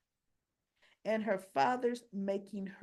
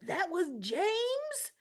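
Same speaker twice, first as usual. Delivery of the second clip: high, slow, drawn-out astonished question; amazed realization; 10 semitones higher, much slower than usual. Retold or imitated. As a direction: let the realization dawn, stretching it out. Mother realizes the boy is her son.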